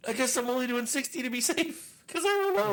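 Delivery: high, loud mock panic; imitating own cluelessness. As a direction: pitch it way up in exaggerated helplessness. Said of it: "So I was like".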